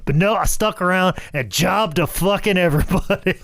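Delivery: fired-up mock complaint; animated retold rant. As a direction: vent it like Tracy would. Retelling Tracy's rant, high and loud.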